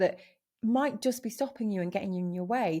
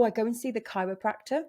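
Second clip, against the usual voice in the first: ordinary talk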